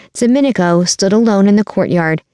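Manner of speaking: usual speaking voice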